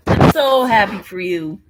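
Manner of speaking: loud, gushing burst; really mean it, out loud; excited congratulations. Real, not acted. Friend congratulating the pregnant friend, loud and gushing.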